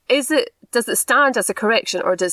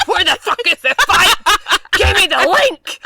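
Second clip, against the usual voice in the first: high, loud, laughing demand to join a fight; excited yelling; 12 semitones higher, 6 dB louder than usual. Acted. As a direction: yell it gleefully, itching to pile in. Eager for a Facebook fight.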